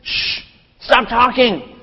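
high, urgent shushing; hushing. Retold or imitated. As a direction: shut someone up quickly. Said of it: "They're like".

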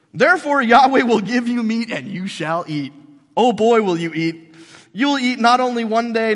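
loud, building divine threat; booming scripture read. Acted. Preacher reading God's threat to the complainers, loud and building. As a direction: thunder it out like an angry God. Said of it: "reading God's words aloud".

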